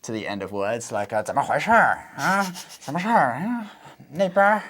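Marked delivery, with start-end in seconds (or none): 1.2-4.6 hammed-up Beijing r-coloured accent; higher-pitched imitation northern Mandarin accent; northeastern Mandarin accent. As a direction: mimic a Beijing speaker, curling every ending into "r". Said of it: "if you hear people adding".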